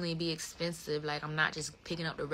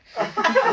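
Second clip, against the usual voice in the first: high laugh; 11 dB louder than usual. Real, not acted. Laughing at her own school exit, a high loud burst.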